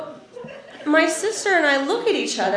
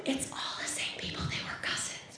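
barely audible mom whisper; secretive whisper; 20 dB quieter than usual. Retold or imitated. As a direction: imitate mom whispering a family scandal.

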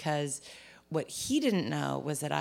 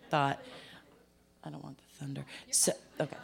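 ordinary talk, nothing marked

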